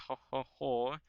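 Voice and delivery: hammed-up Austrian "ho ho" gloating; mock Austrian accent. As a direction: jeer in a put-on Austrian accent. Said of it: "the Austrians are gonna be like".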